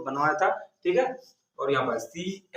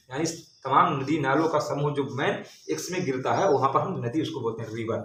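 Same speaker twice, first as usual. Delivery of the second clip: normal talking voice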